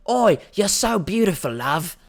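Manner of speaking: put-on Oi and love in a brash British accent; British lad accent. Club guy hits on a random girl, British lad accent. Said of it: "he's like".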